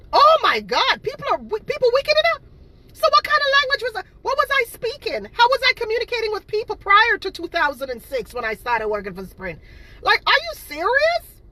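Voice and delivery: high, loud, exasperated ranting at the liars; outraged disbelief. Real, not acted. Vlogger ranting at haters, high and outraged. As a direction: voice shooting up in furious disbelief.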